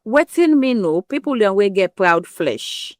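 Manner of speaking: usual speaking voice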